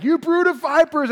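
high, loud prophetic denouncing; fire-and-brimstone prophet. Retold or imitated. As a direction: thunder it like a wild desert prophet. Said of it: "he is just bringing it".

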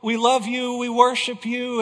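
a lightly voiced worshipper's fervent prayer; crying out to God. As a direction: mimic the congregation crying out in praise. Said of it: "crying out to the Lord and saying".

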